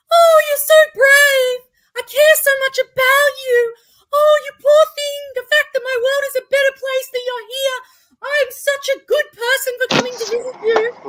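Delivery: high, loud, saccharine gush of pity; hammed-up do-gooder cooing at someone pitiable; gushing charity model. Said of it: "I enacted the charity model for her".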